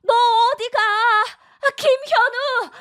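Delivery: high, sharp call after a son storming off; calling after him. Acted. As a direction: yell his name as he leaves.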